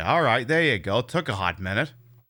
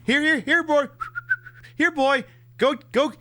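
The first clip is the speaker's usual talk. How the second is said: high, bright call to a dog; calling a pet; 11 semitones higher than usual. Pretend.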